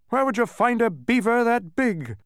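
very high, loud character grumble; high-pitched querulous protest; grumbling Humbug. A narrator's reading. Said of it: "grumbled the humbug".

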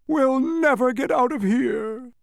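high, loud, drawn-out wail; moaning. Acted. Humbug looking up from the pit, high moaning. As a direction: moan it out in despair. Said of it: "the humbug moaned".

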